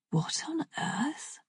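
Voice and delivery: slow hushed whisper to himself; a slow, astonished whisper; whispered thought. A narrator's reading. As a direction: breathe it out in disbelief. Bert's private thought.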